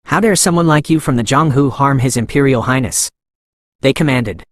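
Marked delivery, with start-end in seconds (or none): none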